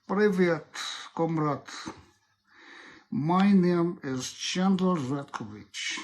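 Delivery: put-on slow, deliberate Russian accent. Telling a suspect he was caught, Russian accent. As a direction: interrogate slowly in a Russian accent. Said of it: "So Russian accent is like this".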